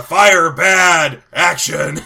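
loud, grunting zombie film director. Acted. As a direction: groan out caveman-like commands as a zombie. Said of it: "zombie Robert Altman".

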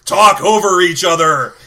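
loud, slow zombie director; lumbering undead film director; Robert Altman as zombie. Acted. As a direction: bellow it out in zombie-speak. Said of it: "zombie Robert Altman".

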